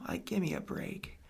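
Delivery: slow, soft unvoiced whisper; hushed, slow give-me-a-break; whispered plea. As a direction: plead quietly under breath. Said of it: "the first thing I thought of".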